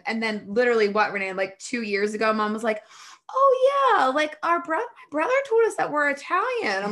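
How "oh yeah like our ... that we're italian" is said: much higher, airy mock-mom voice; imitating mom. Mom passing on what her brother said, imitated in a high put-on voice. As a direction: put on a high-pitched mom, drawing out the opening.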